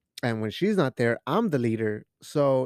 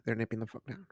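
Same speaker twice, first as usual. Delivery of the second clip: normal talking voice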